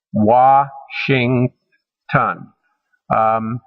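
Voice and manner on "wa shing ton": WASHINGTON broken slowly into three separate syllables. Shown as a demonstration. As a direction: say "Washington" slowly, pausing between Wa, shing and ton. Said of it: "has three syllables".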